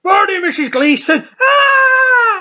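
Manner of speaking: very high, loud greeting breaking into a scream; cheery shout then scream. Retold or imitated. Dougal greeting the topless woman, then shrieking. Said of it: "and he goes".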